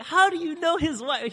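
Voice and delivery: the speaker questioning Joe, amazed and high; astonished question. Retold or imitated. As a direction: squeal it in delighted shock. Astonished at the connection.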